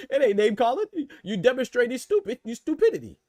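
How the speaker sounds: high, laughing insults; laughing through words. Real, not acted. Streamer scolding chat while laughing, high and amused.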